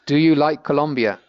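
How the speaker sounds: ordinary delivery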